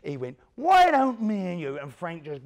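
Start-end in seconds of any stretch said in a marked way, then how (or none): 0.5-1.8 loud, slow menacing challenge; a hard man starting a fight; threatening growl. Retold or imitated. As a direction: square up and spit it out slowly. Jock squaring up, threatening.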